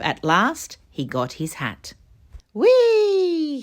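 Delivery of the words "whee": high, loud whee of delight; gleeful cry. A narrator's reading. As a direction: squeal it down the slide. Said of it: "he cried".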